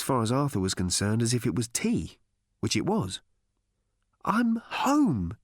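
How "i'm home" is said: elated repeat. A narrator's reading. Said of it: "he repeated".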